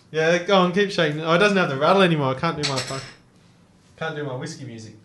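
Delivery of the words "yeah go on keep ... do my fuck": usual speaking voice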